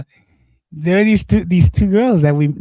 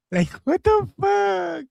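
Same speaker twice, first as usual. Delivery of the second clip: high, slow, disbelieving yell; outraged shout; 3 dB quieter, slower than usual. Real, not acted. Defending himself to his co-host, shouting in disbelief. Outraged at how people portray him.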